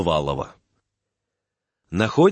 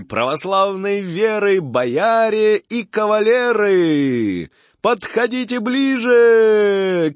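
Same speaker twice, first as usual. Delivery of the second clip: high, slow booming fairground barker; booming circus ringmaster; 9 semitones higher, slower than usual. Acted. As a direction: call out slowly to the crowd like a showman. Barker calls to the fair crowd. (зазывала на ярмарке, протяжно и громко)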